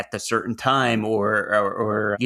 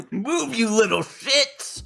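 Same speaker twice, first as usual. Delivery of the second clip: mock road rage; 12 semitones higher, slower than usual.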